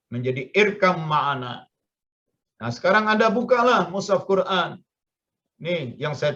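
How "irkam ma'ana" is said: "irkam ma'ana" with one held m, no b; b merged into m. Shown as a demonstration. Teacher shows "irkam ma'ana" with b merged into m. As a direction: melt the b into the m of "ma'ana". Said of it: "dilebur langsung dengan mim".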